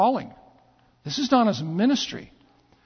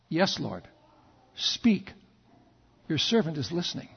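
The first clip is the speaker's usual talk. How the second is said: low, soft, near-whispered reply; hushed answer to God; 5 semitones lower, 7 dB quieter than usual. Acted. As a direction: answer reverently in a hush. Samuel answers God softly.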